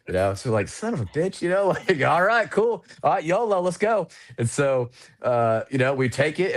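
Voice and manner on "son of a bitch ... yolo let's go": high, fired-up curse then eager go; swear, then rally the crew to move out; exasperated excitement. Retold or imitated. Aircrew hearing of a sudden new op, high and fired up.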